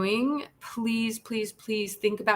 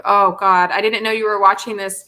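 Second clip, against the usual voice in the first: a louder, startled oh God on finding out they're watching; caught off guard; 8 dB louder than usual. Real, not acted. To Martha's daughter, realizing she's watching the stream, real startled surprise. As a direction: blurt it out, genuinely caught off guard.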